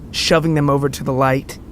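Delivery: ordinary delivery, nothing marked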